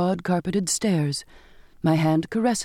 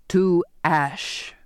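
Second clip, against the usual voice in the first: slow, weighted father's command; stern finality. A narrator's reading. Father ordering the journal burned, slow stern finality.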